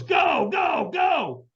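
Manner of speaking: high, shouted urging; urgent send-off shout. Retold or imitated. Trainer launching firewalker onto the coals, urgent shouting. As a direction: yell them off the starting line.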